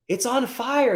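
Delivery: very high mock old lady. Old lady from across the street stating the obvious, high voiced. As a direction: point it out like a fussy old neighbor. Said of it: "the lady goes".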